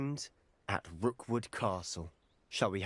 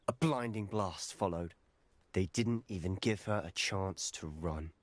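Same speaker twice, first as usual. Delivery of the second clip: ordinary talk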